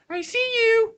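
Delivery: very high, loud sing-out; eerie voice. Acted. Imitating a mystery voice in the woods, creepy and high. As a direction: taunt from the dark in a put-on falsetto. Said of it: "this voice is like".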